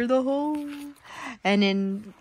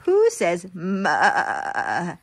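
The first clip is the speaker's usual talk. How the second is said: slow drawn-out question; 8 semitones lower, much slower than usual. Acted. Reading goat page.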